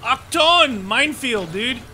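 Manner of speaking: high, loud alarmed yell; excited shout. Real, not acted. Alarmed at minefield.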